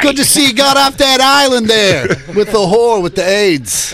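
high, loud, slow put-on blather; hammed-up over-familiar shout; Bobby Kelly. Playing a comic accosting Tom Hanks, Bobby Kelly impression. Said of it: "Can I be Bobby Kelly".

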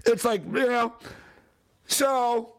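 ordinary talk, nothing marked

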